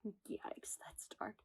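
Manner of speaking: wry whisper to herself; whispered self-comment. Acted. Woman recoiling from her own sad answer, whispering. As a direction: mutter it under your breath.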